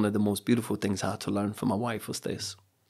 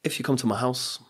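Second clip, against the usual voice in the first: ordinary delivery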